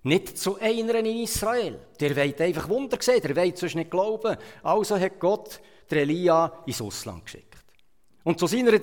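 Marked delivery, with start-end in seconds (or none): none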